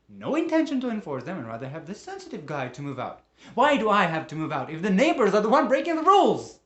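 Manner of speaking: indignation building to a full yell; outraged shouting. Acted. Tenant told to move out over noise, outraged shouting. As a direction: erupt into a high, furious shout. Rant peaks over being evicted.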